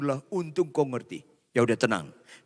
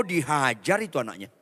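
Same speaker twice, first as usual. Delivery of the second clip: usual speaking voice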